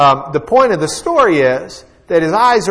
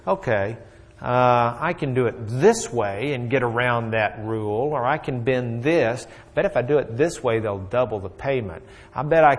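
scheming inner thoughts; 4 semitones lower, 7 dB quieter than usual. Retold or imitated. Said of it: "Balaam was thinking".

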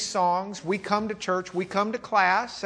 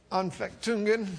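higher-pitched verbal shrug; resigned shrug; 4 semitones higher, 4 dB quieter than usual. Acted. Spouse asks what is wrong, answered with a shrug. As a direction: say it like a helpless shrug. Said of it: "kind of shrug your shoulders and say".

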